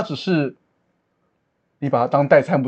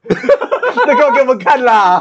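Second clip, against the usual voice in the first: high, protesting through laughter; laughing protest; 9 semitones higher, 5 dB louder, slower than usual. Real, not acted. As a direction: object while cracking up. Laughing protest at friend's rude reply.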